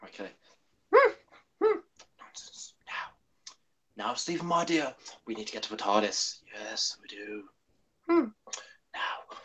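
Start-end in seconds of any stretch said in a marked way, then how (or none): 2.2-9.4 soft, muttering Doctor; hushed, halting character voice that drops away; Hartnell impression. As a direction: fuss quietly like the Doctor. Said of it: "gotta do a Hartman impression with me".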